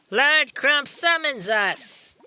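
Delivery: high, drawn-out cartoon voice; SpongeBob. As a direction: call it out slowly in a high cartoon voice. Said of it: "I read that in a SpongeBob voice".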